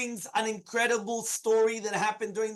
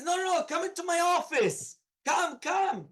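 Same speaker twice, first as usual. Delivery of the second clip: lightly acted officer toying with his victim, higher voice; higher-pitched mock invitation from an officer; toying Gestapo chief; 7 semitones higher than usual.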